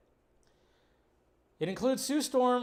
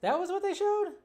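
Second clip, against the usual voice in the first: sharply higher, incredulous questions; baffled disbelief; 12 semitones higher, 3 dB louder than usual. Retold or imitated. Fan reacting to the shown scene, baffled and incredulous. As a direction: act stunned by the footage they chose. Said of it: "I'm, like, going".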